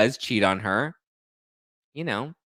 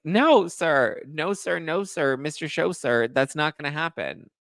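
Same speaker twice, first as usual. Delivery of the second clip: high-pitched, scolding no-sir; indignant refusal; 6 semitones higher, slower than usual. Retold or imitated. Viewer scolding the man asking a hug, indignant. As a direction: refuse him outright, pitched way up.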